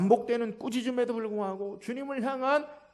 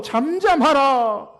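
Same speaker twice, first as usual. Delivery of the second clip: loud, drawn-out, higher command; slow, raised mock scolding; acted rebuke; 6 semitones higher, 9 dB louder, much slower than usual. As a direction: imitate a crowd scolding someone into silence. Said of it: "꾸짖어".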